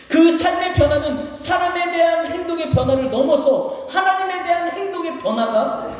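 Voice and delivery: high, stretched-out preacher's delivery; forceful preaching. Real, not acted. Pastor telling the congregation advice alone fails, forceful preaching. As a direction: drive it home from the pulpit, pitched high.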